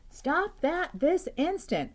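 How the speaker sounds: high, loud, slow motherly order; stern mom command. Acted. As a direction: order him slowly like a cross mom. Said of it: "David's mom always said".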